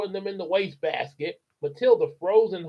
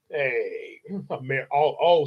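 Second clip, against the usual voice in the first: ordinary delivery, nothing marked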